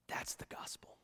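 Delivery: a whispered, reverent aside. Real, not acted. Preacher letting the movie quote sink in, whispered. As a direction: breathe it out in a whisper. Hushed aside after the film scene.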